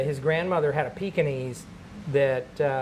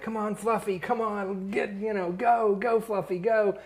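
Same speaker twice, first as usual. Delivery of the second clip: high, eager urging of a dog; cheering on the dog; 6 semitones higher than usual. Retold or imitated. Kid's friend cheering the dog on, high urging. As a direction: egg the old dog on, pitch up. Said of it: "my friend's like".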